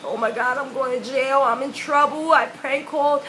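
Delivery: retold frantic inner monologue, pitch climbing; mock panic of a kid in trouble; panicking inwardly. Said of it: "going crazy in my head".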